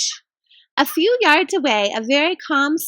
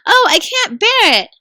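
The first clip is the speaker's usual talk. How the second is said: distressed; 8 semitones higher, 6 dB louder than usual. Acted. Lucy frightened by the pull.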